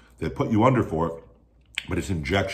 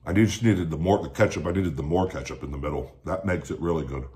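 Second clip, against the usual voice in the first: eating between sentences; talking while chewing. Real, not acted. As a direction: mouth partly full of food. Seen 0: Holds bitten sandwich at mouth, jaw working between words, bites at 3.9.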